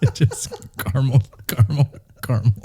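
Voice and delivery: giggling through the words; laughing. Real, not acted. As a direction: say it while cracking up. Mocking caramel debate laughing.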